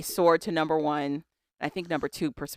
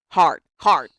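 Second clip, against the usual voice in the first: punched, louder single words; emphatic; 10 dB louder than usual. Real, not acted. Host insists to cohost, loud and emphatic. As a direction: hit each word hard and short.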